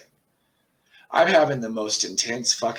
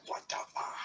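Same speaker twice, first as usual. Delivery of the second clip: silent, breathy what the fuck; under the breath; 12 dB quieter than usual. Retold or imitated. Reacting to daughter's eerie phone call, breathless whisper. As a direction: mouth it almost without voice. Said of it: "I didn't say that out loud".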